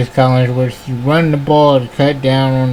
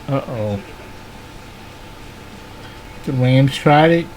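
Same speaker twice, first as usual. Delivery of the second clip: usual speaking voice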